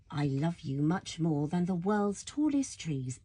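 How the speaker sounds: usual speaking voice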